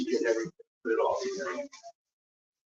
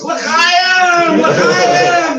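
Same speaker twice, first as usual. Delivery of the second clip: high, loud toasting cry; party shout of a cheer; festive shout; 13 dB louder than usual. Retold or imitated. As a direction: bellow the toast to the whole car. Rabbi in costume toasting.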